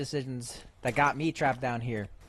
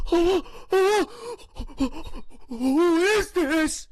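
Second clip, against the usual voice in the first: much higher, louder mock voice; mimicking the character; 6 dB louder, much slower than usual. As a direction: parrot the line in a squeaky voice. Echoes the game line.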